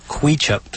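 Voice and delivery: "Queechup" said as KWEE-chup; pronounced KWEE-chup. Shown as a demonstration. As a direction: give "Queechup" a long EE, like "kwee". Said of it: "it should be pronounced Queechup".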